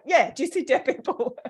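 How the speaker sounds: a hint of the masseur; masseur quoted. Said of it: "she's like".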